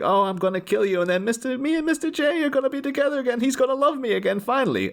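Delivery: high-pitched giddy villainess; Harley Quinn. Acted. Co-host voices her telling captive Batman her plan, high-pitched giddy gloating. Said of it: "she's all talking about".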